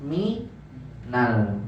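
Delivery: MI-NAL, an a linking min to al, low and soft; linked with fathah. Shown as a demonstration. Teacher recalling the earlier example, MINAL with an a. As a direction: split it softly as MI, NAL. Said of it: "Yang tadi".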